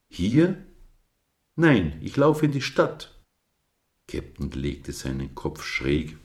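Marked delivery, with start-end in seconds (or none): 0.1-3.1 higher, louder character voices; surprised question. A narrator's reading. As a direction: toss the short lines back and forth, pitched up. Dialogue of lamb and ram.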